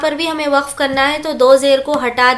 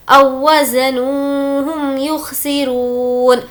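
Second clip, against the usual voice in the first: slow recitation; much slower than usual. Shown as a demonstration. Teacher recites verse.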